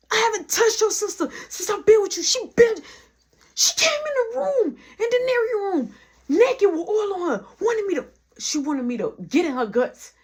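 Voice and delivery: very high, loud defensive outburst; heated protest. Retold or imitated. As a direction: protest your innocence, voice shooting way up. Retold defensive outburst in quarrel.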